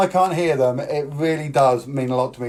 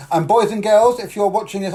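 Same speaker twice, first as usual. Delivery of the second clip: put-on kids party magician; 7 semitones higher, 5 dB louder than usual. Addresses kids watching at home.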